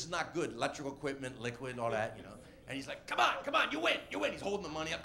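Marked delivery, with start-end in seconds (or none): 3.1-4.3 high, urgent calling out; urgent pleading. Retold or imitated. Club owner begs the comic to leave the stage, urgent high calls. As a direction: imitate someone frantically calling it off.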